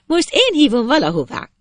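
put-on high-pitched girl calling up; girl's voice. A narrator's reading. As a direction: invite the boy in a high voice. Girl invites the boy from below.

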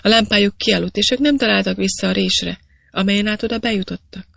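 ordinary delivery

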